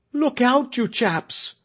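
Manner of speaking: high, urgent frightened call; frightened warning. A narrator's reading. A voice warning the crowd the governor is coming, frightened. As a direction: warn them in alarm. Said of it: "a frightened voice".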